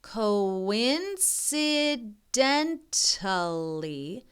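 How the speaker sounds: very slow syllable by syllable; slow careful reading; sounding it out. Shown as a demonstration. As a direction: sound out a big word. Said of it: "this is a big wor".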